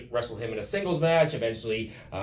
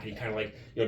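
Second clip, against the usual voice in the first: ordinary talk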